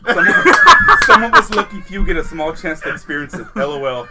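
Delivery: laughing through a quote. Retold or imitated. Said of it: "And I go".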